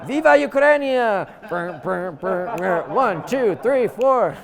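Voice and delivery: loud, high hyped fitness-class leader counting off; Zumba instructor. Pretend. Zumba teacher leading the Sunday class at the Ángel, loud and hyped.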